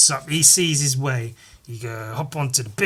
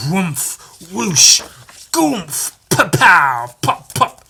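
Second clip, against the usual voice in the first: loud mouth-made whooshes and impacts; explosive whoosh, thud and pops; fight sound effects; 4 semitones higher, 8 dB louder, much slower than usual. Retold or imitated. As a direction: act out comic-book blows with noises. Imitating fight noises.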